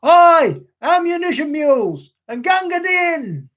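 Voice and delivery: shout. Acted. Front rank shouting in poem.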